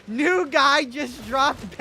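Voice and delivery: loud, very slow, stretched-out hype call. Pretend. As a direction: draw every word out for the crowd.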